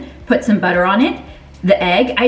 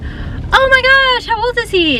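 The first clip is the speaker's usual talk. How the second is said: excited gush. Retold or imitated. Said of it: "And so I was like".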